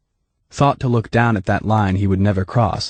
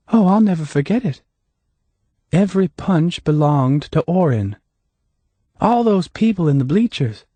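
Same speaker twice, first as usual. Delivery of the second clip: lightly voiced accuser; 7 semitones higher than usual. A narrator's reading. Hannah confronting the deputy about the fight, lightly voiced. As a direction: voice raised, accusing a man.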